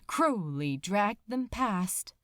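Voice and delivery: plain reading voice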